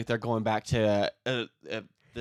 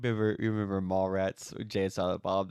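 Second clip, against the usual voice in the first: ordinary talk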